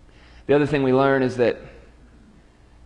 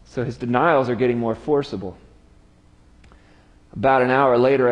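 ordinary talk, nothing marked

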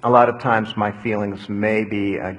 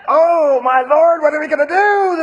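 high, loud alarmed cry for help; panicked servant; 8 dB louder than usual. Acted. Servant panicking to his master, high and loud. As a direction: sound like the walls are closing in. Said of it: "the servant said".

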